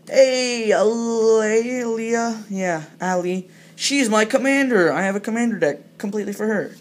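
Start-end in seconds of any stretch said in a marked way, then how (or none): 0.1-3.5 higher, fond hello to a favourite card; delighted greeting. Real, not acted.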